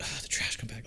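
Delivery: unvoiced, disappointed whisper; disappointed groan. Kid dreading the trash compactor scene, whispered. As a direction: sigh it out with dread. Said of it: "I'd be like".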